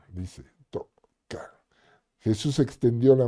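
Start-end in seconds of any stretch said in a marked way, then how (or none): 0.1-1.6 soft slow whisper, almost breathless. Acted. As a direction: breathe the words out slowly and unvoiced. Whispered narration of touch. (susurro lento)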